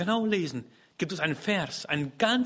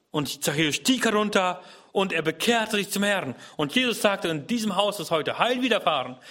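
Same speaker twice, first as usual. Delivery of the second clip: higher, louder preaching with stress on wirst; fervent preaching; 6 semitones higher, 4 dB louder than usual. Acted. Preacher promising the congregation, fervent preaching. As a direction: proclaim it to the hall.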